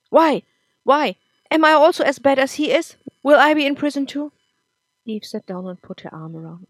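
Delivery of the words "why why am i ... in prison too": high, loud, desperate questions; panicked outburst. Acted. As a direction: fear spilling out in a rush. Nick panics about his father.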